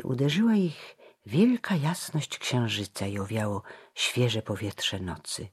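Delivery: ordinary delivery, nothing marked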